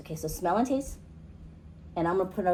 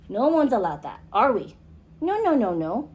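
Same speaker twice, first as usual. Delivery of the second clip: normal talking voice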